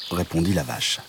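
ordinary delivery

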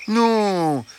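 high, soothing fox voice; calm the hens like a sly gentle fox; reassuring fox. A narrator's reading.

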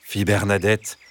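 usual speaking voice

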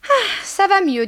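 high sighing exclamation, loud; relieved landlady. Acted. Madame Dehan after a glass of elixir, loud relief.

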